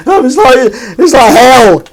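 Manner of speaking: very high, loud hammed-up wail; mock despair. Sixty tins still to wash, high mock-despairing wail. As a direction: cry it out in exaggerated agony.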